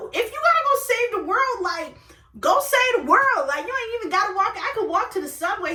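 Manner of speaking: high, emphatic send-off; acted animated exasperation; exasperated urging. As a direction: urge him off with pitch way up. Acted urging him to leave.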